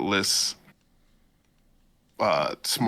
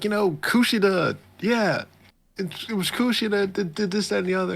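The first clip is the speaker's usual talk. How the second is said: high mock accusation; 12 semitones higher than usual. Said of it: "She's like".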